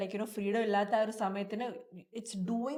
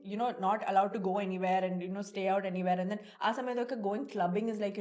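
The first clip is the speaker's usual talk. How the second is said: ordinary talk, nothing marked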